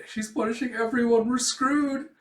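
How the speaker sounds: high, dismayed student voice; acted rising dread; panicked. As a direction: voice a hopeless alarm. Emil panics.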